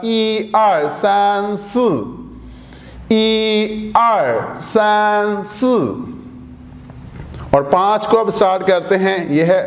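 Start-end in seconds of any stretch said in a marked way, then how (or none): 3.1-5.6 each syllable stretched out slowly; teacher sounding out the word very slowly; slow drawn-out reading. Shown as a demonstration. Teacher to students, spelling out the word very slowly. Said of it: "अगर मैं चार तक इकट्ठा पढ़ूं".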